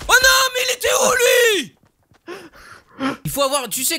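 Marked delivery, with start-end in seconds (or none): 0.1-1.8 loud, fast, high frustrated yell; frustrated shout. Real, not acted. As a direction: shout it out in disbelief. Sudden enemy kills them.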